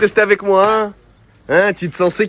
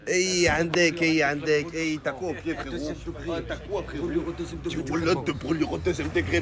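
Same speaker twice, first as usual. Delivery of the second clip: soft and caring; 7 semitones lower, 5 dB quieter than usual. Real, not acted. Soothing a burned friend.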